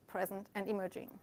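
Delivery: ordinary talk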